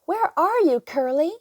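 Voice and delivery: high, loud call across the farm; searching call, high and loud. Acted. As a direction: call out for the lost pig. Calling for lost pig.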